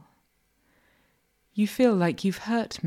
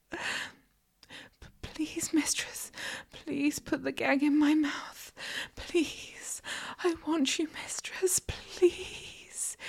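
high, breathless whispered plea; stammer, then beg in a tiny high whisper; soft, whimpery submissive begging; begging and pleading; 10 semitones higher, 6 dB quieter than usual. A narrator's reading. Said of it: "beg and plead".

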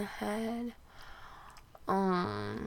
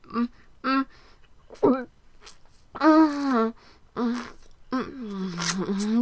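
moaning; 5 dB louder than usual. Acted. Wordless moaning.

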